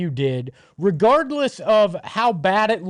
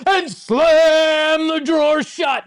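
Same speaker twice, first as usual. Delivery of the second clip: dramatic shouting; 8 semitones higher, slower than usual. Acted. Dramatic morgue send-off for opponent.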